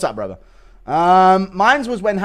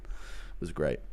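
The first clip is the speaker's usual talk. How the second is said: usual speaking voice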